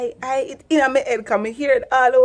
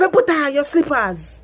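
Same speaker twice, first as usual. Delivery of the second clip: louder, higher Patois order; Jamaican mum command; 4 semitones higher, 3 dB louder than usual. Retold or imitated. Mother ordering her daughter in Patois. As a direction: bark it at the kid. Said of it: "Me say".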